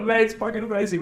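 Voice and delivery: overjoyed Aussie mate exclaiming mid-hug; joyful exclaim. Retold or imitated. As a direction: shout the joy of the reunion. Joyful reunion after the reenactment.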